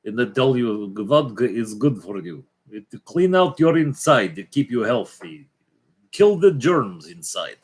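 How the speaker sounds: hint of a Russian accent; heavy foreign-accent vodka pitch. Vodka praise in put-on voice.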